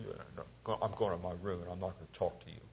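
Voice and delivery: put-on a sulking king who sounds like a child; pouting child. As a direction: sulk it out like a kid sent to his room. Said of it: "he was pouting".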